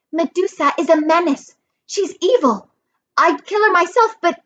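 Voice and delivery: put-on high-pitched urgent girl's voice; earnest, insistent Annabeth. A narrator's reading.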